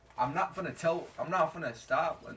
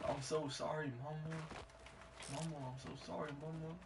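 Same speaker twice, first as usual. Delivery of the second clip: quiet apology; 9 dB quieter than usual. Real, not acted. Apologizing to sleeping mom.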